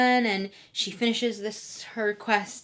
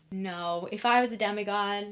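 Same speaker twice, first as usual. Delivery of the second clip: ordinary talk, nothing marked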